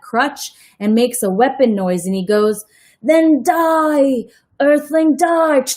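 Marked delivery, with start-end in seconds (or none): 3.0-5.6 a hammed-up alien threat, higher, louder; mock robot death threat. Freak fires his crutch at Max, hammed-up robot threat. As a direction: kid playing an evil robot.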